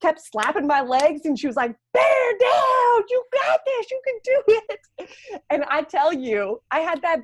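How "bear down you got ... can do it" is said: high, loud drill-coach urging; yelling nurse. Retold or imitated. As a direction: yell her through the push. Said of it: "she was just yelling at me".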